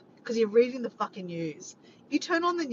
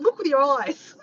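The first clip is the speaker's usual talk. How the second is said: loud, higher mock yell; yelling back down; 4 semitones higher, 7 dB louder than usual. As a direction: shout it down, half in fun. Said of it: "I'm yelling back down".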